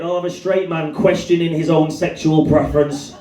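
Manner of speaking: usual speaking voice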